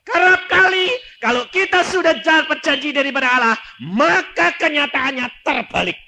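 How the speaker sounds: high, loud, ringing pulpit cry; preaching at full force. Acted. Preacher warning the congregation about doubt, at full force. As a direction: preach it out to the whole hall.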